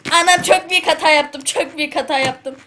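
high, loud dismayed outburst; alarmed cry. Real, not acted. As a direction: blurt it out in alarm. Sudden mistake in game.

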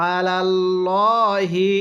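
drawn-out chanted recitation; slow melodic Quranic chant. Shown as a demonstration. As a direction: recite it like a tajweed teacher. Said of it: "এরপরে".

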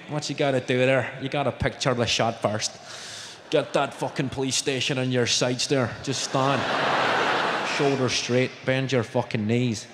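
low-pitched Belfast coaching voice; Belfast golf coach. Acted. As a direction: give deadpan instructions in a low Belfast voice. Plays Belfast golfer giving coaching.

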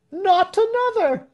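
very high shrieked echo; crowd shriek. A narrator's reading. Crowd echoing the smith's praise, shrieking. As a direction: shriek it high, like everyone at once. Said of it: "shrieked everybody".

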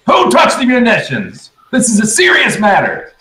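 high-pitched acted scolding; indignant demand. Sun demanding answers of the waking crew, indignant. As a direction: call it out high, insisting it is serious.

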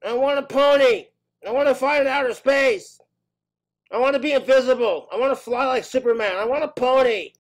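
put-on loud, high-pitched demanding child; high, insistent kid tantrum; bratty kid. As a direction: shout each demand like a spoiled little kid. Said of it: "Junior's sitting there going".

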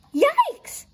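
high, loud startled yelp; yell. A narrator's reading. Jojo hears a spooky shriek, high yell. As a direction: yell it out in fright. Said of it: "yelled Jojo".

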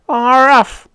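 high, loud howl ending in a bark-like syllable; native's howl. A narrator's reading. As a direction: howl like an excited alien. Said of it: "howled the native".

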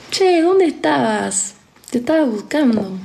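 put-on slow, lilting Argentine accent with high swoops; sing-song Argentine accent. Imitating how Argentines talk for followers, sing-song Argentine accent. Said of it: "hablan un poco así como que cantado".

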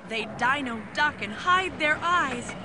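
ordinary delivery, nothing marked

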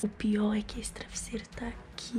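tense hushed whisper. Real, not acted. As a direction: whisper nervously beside a sleeper. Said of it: "eu já tô falando baixo porque ele tá dormindo".

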